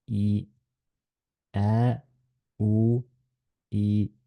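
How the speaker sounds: ordinary talk, nothing marked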